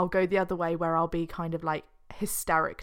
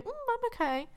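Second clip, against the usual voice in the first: very high, soft mock reassurance; mock brave face; 3 dB quieter, faster than usual. Teasing her friend's high little 'I'm okay'. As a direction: squeak it out like you're fine. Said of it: "And now you're going".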